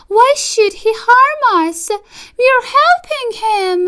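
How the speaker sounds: wife defending the fox to her husband, squeaky mouse voice; small mouse wife. Acted. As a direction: pipe it up like a tiny mouse lady. Mrs DeSoto's voice.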